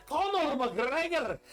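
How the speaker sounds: mock Scottish, rolled R's. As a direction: ham up a bad Scottish accent and trill the R's. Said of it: "He, like, rolled his R's".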